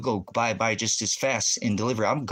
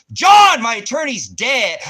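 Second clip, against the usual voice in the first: much louder, higher, slow shout; anguished yell; 11 semitones higher, 11 dB louder than usual. Retold or imitated. Man retelling begging his attorney, anguished yell. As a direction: shout it as if pleading with the lawyer. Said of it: "why does nobody care when I say".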